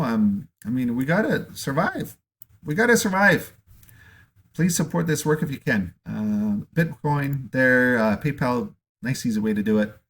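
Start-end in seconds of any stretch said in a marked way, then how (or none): 0.9-5.9 urgent plea. Real, not acted. Speaker urging viewers to support him, higher and pleading. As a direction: beg them to hold on.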